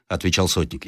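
ordinary delivery

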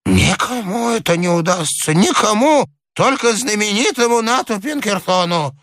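put-on high-pitched insistent old man; high, fretful harping on one idea; obsessed old man. A narrator's reading.

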